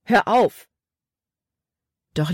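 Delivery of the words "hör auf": sharp, higher-pitched order; commanding. A narrator's reading. Caleb orders Libby to stop crying, commanding. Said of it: "befahl Caleb".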